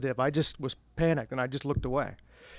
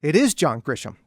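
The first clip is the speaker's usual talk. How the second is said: higher, louder mock yell to her husband; retold scream across room; 5 semitones higher, 7 dB louder than usual. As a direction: call out triumphantly across a restaurant. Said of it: "screamed across the restaurant to her husband".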